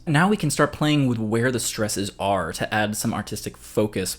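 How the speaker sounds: normal talking voice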